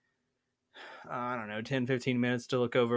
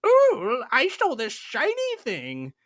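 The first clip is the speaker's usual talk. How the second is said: squeaky high goblin, much louder, greedy and gleeful; 12 semitones higher, 8 dB louder, slower than usual. Retold or imitated. Players acting as goblins, gloating over a shiny thing in a high squeal. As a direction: play a goblin cooing over loot. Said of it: "pretending to be goblins going".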